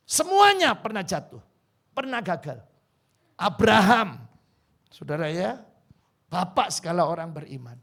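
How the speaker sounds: loud, high, ringing preacher's call; preaching at full force. Acted. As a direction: proclaim it to the congregation. Preacher building intensity. (berkhotbah dengan lantang dan bersemangat)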